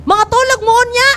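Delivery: ordinary talk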